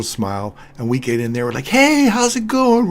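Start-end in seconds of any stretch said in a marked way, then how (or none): 1.7-2.9 much higher, louder, slow gushing greeting; super friendly hello. Retold or imitated. Tourists greeting their driver on getting in, high loud gushing hello. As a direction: sing it out like an overeager tourist. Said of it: "We're all super friendly".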